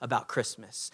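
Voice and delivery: soft confiding whisper; gently sharing a secret with a friend, whispered; gentle whisper. Pretend. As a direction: lean in and whisper it kindly. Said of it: "gently and graciously".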